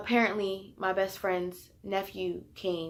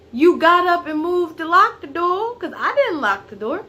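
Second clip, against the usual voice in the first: shouting; 9 semitones higher, 9 dB louder than usual. Retold or imitated. Retold argument with mom.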